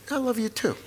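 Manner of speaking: high-pitched, offhand answer; mock dad brushing off affection; imitated dad's reply.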